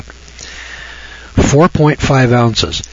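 very slow, each word spaced for note-taking; dictating slowly. Shown as a demonstration. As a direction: dictate "Four point five ounces" so they can write it. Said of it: "Write this down".

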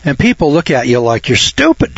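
ordinary talk

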